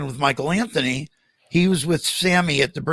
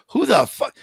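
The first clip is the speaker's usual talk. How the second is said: 1 imagined startled snap; 8 semitones higher than usual. Retold or imitated. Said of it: "expected him to turn around and go".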